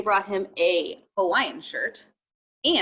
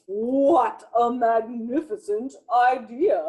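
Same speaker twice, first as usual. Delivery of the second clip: slow, grand and pleased tiger voice; delighted tiger; 5 dB louder, slower than usual. Acted. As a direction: savour every word like a thrilled tiger.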